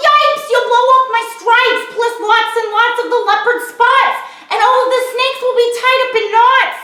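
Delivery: high, loud yelling zebra. A narrator's reading. Zebra yelling at the elephant, high and loud. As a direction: yell it out in alarm. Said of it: "The zebra yelled".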